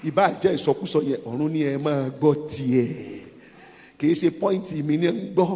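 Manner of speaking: usual speaking voice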